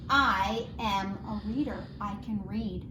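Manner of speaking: ordinary talk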